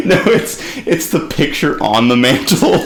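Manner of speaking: high laughing protest. Real, not acted. Laughing at the mantle joke.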